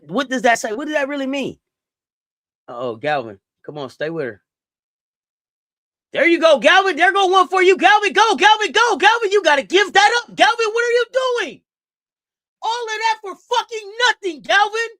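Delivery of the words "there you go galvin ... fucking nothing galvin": much higher and louder hollering at the screen; loud cheering. Real, not acted. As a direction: cheer her on, then groan at the miss. Cheering then scolding a player.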